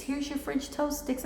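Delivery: high, soft sweet cashier voice; high, soft cashier's line. Retold or imitated. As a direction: play the friendly server at the window. Voicing the friendly cashier.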